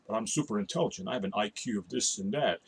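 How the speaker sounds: low, hushed mock nerd voice; know-it-all nerd. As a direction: play a self-important nerd, dull and quiet. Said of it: "They sound like the voice of a troll".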